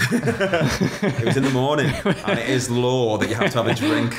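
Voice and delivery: slow, giggling admission; real laughter all through; laughing. Real, not acted. Laughing about the stag do flight.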